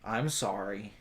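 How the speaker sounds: low, very soft, reluctant sorry; meek apology. Acted. Adventurer told to apologize to scarecrow, low and meek. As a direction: say it small and low.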